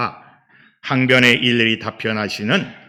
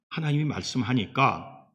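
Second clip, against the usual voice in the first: normal talking voice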